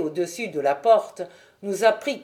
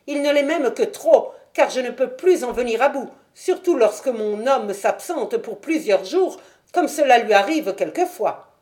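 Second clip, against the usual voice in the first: higher, louder talkative boatman's wife; a fussy wife rattling on to a gentleman; Mrs Smith; 3 semitones higher, 4 dB louder than usual. Acted. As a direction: chatter up high like a flustered landlady. Landlady voiced by narrator.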